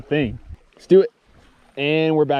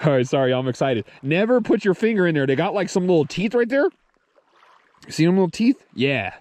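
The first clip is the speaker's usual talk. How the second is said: usual speaking voice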